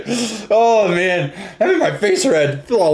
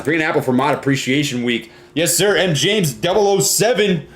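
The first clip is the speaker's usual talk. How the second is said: normal talking voice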